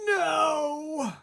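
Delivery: high, drawn-out groaning no. Acted. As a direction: mom despairing. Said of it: "my mom groaned".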